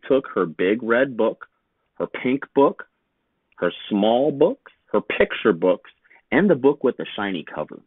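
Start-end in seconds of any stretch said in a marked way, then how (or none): none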